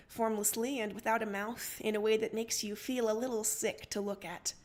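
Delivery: ordinary delivery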